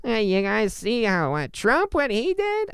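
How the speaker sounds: high, slow, mocking pundit with a laugh; mock political podcaster. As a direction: play an eager politics-podcast host. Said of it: "I don't wanna take politics and be like".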